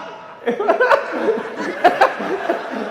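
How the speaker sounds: high shrieking laugh; cracking up hard; loud laughter. Real, not acted. Laughing at the shirt.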